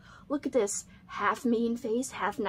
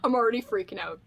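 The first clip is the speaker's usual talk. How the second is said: ordinary talk, nothing marked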